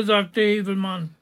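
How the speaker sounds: ordinary delivery, nothing marked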